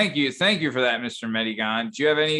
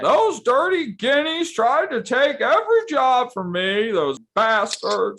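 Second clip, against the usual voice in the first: high, loud, slow wailing shout; hammed-up sob of lost dreams; mock wailing; 11 semitones higher, 4 dB louder, slower than usual. Wailing about wanting Sonny role.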